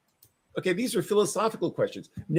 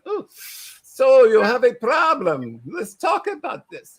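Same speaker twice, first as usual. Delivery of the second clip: mock German therapist accent, higher, louder and slow; 7 semitones higher, 6 dB louder, slower than usual. Joking about friends playing therapist, mock German accent. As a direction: ham up a German Freud-style shrink accent. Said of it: "talk in little German accents".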